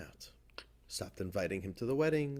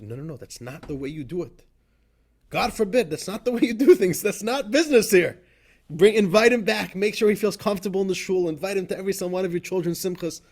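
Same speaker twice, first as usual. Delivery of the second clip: loud, much higher, indignant rebuke; upset Rebbe; 6 semitones higher, 8 dB louder than usual. Retold or imitated. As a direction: scold them into bringing him back. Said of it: "And the Rebbe was very upset".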